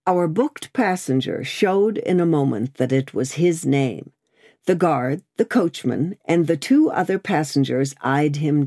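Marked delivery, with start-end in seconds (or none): none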